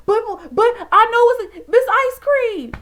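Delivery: high, loud, drawn-out protest; insistent eager protest. Retold or imitated. Student insisting to teacher, high eager protest. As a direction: insist you know the answer, drawn out. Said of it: "I was like".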